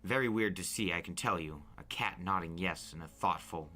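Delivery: ordinary delivery, nothing marked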